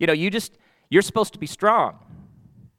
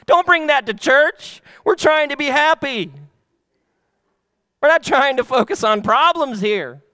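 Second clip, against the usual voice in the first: higher, louder put-on churchgoer voice; mock churchgoer; 10 semitones higher, 6 dB louder than usual. Pastor mocking a churchgoer who hides problems, higher and louder. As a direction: lampoon the churchgoer who wants everything happy, pitched up. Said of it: "You're like".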